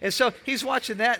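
ordinary delivery

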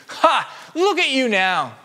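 high, loud taunting ha; mocking jeer. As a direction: sneer at him like the jeering crowd. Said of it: "shaking their heads in mockery".

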